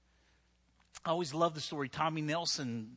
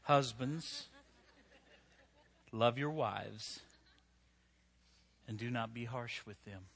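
scripture reading; 5 semitones lower than usual. Acted. Scripture read slowly and softly.

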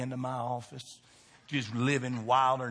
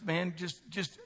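usual speaking voice

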